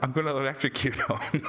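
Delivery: giggling through the line; barely holding back laughter; voicing the scientist with a laugh breaking through; laughing quote.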